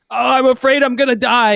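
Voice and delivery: high, loud whimpering dread; mock-terrified patient cry; hammed-up fear. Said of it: "the guy on the ground kinda goes like".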